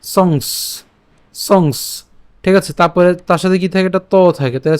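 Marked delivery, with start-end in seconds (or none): 0.0-2.0 "সংস" cut off on the dental s; partial word demonstration. Shown as a demonstration. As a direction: stop at the final s of "সংস", with no vowel after it. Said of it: "সংস পর্যন্ত অর্থাৎ দন্তাস পর্যন্ত উচ্চারণ করছি".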